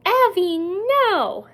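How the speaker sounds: put-on loud, high child's cry of warning; sharp high yell at the toddler; retold yell. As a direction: shout it out like the alarmed big sister. Daughter yelling at little brother.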